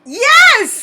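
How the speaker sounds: high, loud burst of yes; excited yes. Real, not acted. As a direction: jump on the answer. Excited answer to question.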